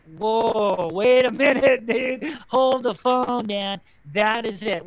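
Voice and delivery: loud, amazed outburst; excited exclamation. Retold or imitated. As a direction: blurt it out in sudden excitement. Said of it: "And I was like".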